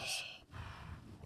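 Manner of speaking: soft, breath-only sigh; heavy sigh. Real, not acted. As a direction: let out a long voiceless sigh. Reacting to regret on people's faces.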